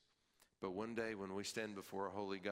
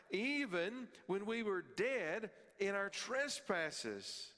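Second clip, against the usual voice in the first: impassioned preaching; 11 semitones higher, 3 dB louder than usual. Acted. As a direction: proclaim the verse with fervor. Preaching at raised pitch.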